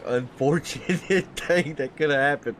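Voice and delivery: fighting back a laugh while consoling; stifled laughter. Real, not acted. Booth host consoling a driver after his crash, stifled laughter. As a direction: try to stay sincere as laughter breaks in. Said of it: "I lost complete composure up here".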